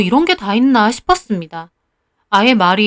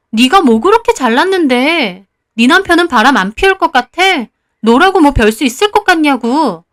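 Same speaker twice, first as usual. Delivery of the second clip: loud, angry tirade with raised pitch; loud cursing outburst; 3 semitones higher, 4 dB louder than usual. Acted. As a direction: lash out at a friend loudly. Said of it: "그 목소리가 너무 커서". (큰 소리로 퍼붓는 분노)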